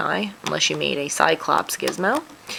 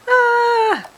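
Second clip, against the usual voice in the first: startled ah; 4 dB louder than usual. Real, not acted. Battery light flashing, startled.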